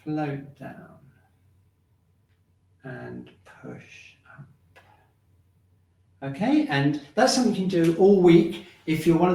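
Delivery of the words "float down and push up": slow, drawn-out qigong cues, growing softer; slow guided movement cues. Acted. Qigong teacher guiding students through sinking and floating, slow and soft. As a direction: stretch each cue like a slow tide.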